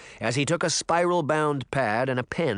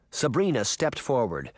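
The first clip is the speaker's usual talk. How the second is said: ordinary delivery, nothing marked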